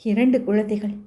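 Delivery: ordinary delivery, nothing marked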